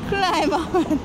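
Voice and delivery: excited coaxing. Pretend. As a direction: egg the little climber on. Coaxing the koala again.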